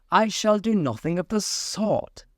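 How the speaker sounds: high indignant refusal; huffy mouse refusing; offended mouse. A narrator's reading. Mouse refusing Alice, high and indignant. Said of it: "said the mouse".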